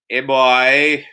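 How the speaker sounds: drawn-out Trinidadian complaint; whining Trinidadian accent. Acted. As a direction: whine it like a moo in Trinidadian accent. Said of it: "sounds like people are constantly whining".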